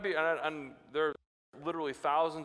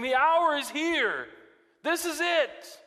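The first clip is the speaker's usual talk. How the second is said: very high, loud, slow; intense proclamation; 10 semitones higher, 6 dB louder, slower than usual. Retold or imitated. Jesus to disciples in Gethsemane, high loud urgency. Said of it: "Jesus is saying".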